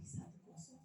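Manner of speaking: very soft aside, almost no voice; inner voice. Retold or imitated. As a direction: say it under your breath, amused. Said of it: "Saya bilang dalam hati".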